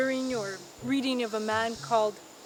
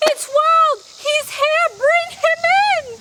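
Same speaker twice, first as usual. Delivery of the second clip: much higher and louder, bursting with joy; overjoyed; 11 dB louder than usual. A narrator's reading. Shackleton greeting his old friend's arrival, joyful shout. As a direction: shout it out in delight.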